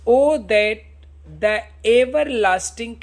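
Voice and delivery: slow, drawn-out reading of Shakespeare; slow recitation. Shown as a demonstration. Teacher reading Hamlet's soliloquy to students, slow solemn recitation. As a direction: recite each word slowly and solemnly.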